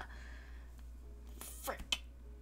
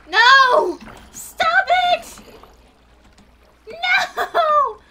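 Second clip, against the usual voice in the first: very loud, high frantic yells; panicked shrieking; 11 semitones higher, 15 dB louder, much slower than usual. Real, not acted. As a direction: shriek desperately to stop it. Axolotl is in danger.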